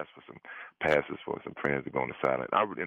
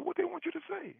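much higher, mock-incredulous friend voice; imitating his friend; 5 dB quieter than usual. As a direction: squeak it out like the buddy who can't believe it. Friend on phone, voiced by teller.

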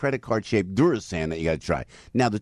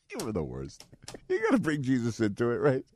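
ordinary delivery

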